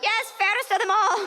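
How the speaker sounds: high simpering maid voice; mock fawning maids. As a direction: fawn over the bride like a servant. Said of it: "They're like".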